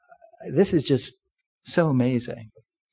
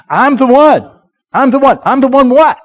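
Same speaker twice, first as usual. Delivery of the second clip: raised, punchy call for the church to echo; congregation call-out; 8 semitones higher, 7 dB louder than usual. Shown as a demonstration. Preacher pushing the church to echo him loudly. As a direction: lead the call and response. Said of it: "Say this with me".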